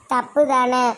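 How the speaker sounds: ordinary talk, nothing marked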